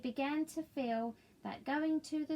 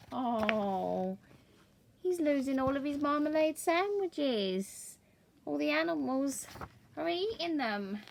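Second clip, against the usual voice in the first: mock dismay; 5 semitones higher, 5 dB louder than usual. Mock dismay to child listeners.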